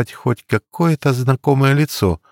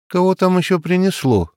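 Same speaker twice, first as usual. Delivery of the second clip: higher, irritated call toward the door; annoyed shout; 8 semitones higher than usual. A narrator's reading. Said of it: "крикнул Андрюха".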